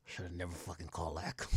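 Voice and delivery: low, soft regretful [name]; lightly voiced muttering [name]; imitating [name]. Streamer imitating [name] regretting the call, low and soft. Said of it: "You know what [name] said?".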